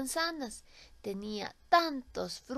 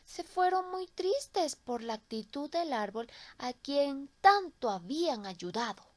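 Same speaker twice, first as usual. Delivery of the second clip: ordinary delivery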